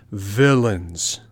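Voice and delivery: put-on fox voice, indignant. A narrator's reading. Fox condemning the robbers, mildly indignant voice. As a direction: pronounce it with mild indignation. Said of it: "said the fox".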